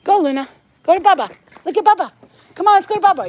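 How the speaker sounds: high, loud pet-talk coaxing; high sing-song calling to the dog. Real, not acted. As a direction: call the dog over sweetly.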